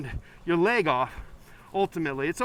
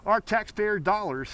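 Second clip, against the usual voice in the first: normal talking voice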